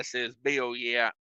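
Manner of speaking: usual speaking voice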